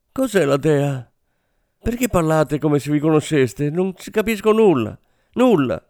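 frightened woman losing control; shrieked. A narrator's reading. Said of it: "strillò".

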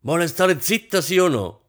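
high, loud, exasperated demand; angry demand. A narrator's reading. Husband telling wife to shut up, angry. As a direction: order silence, out of patience.

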